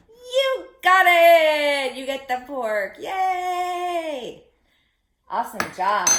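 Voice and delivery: loud high slow praise; cheering. Acted. Froggy praising the children, cheering loudly. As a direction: cheer the kids on.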